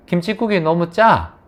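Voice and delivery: normal talking voice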